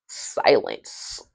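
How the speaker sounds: hushed whisper. Pretend. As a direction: breathe it out, barely voiced. Awkward pause described.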